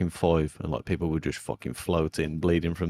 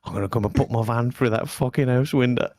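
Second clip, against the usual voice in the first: higher, louder mock threat from the raging caller; retold angry threat; 6 semitones higher, 4 dB louder than usual. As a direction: imitate the caller spitting out his threat. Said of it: "Fucking screaming down the phone".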